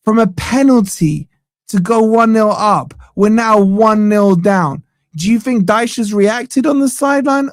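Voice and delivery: ordinary talk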